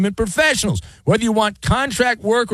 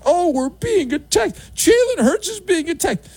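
mock panic; 10 semitones higher than usual. Mocking panicked fans.

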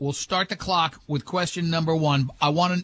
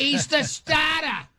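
high, slow New England "stah-tah"; mock New England local, R's dropped, pitched way up; insane New England accent; 10 semitones higher, slower than usual. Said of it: "never heard an accent so insane".

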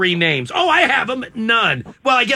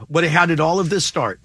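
normal talking voice

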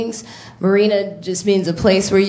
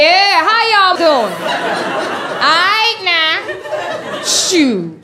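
big sassy woman on stage; 9 semitones higher, much slower than usual. Pretend. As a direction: ham up a big woman working the crowd. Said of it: "when the big women get up there".

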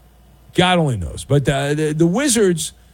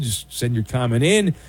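usual speaking voice

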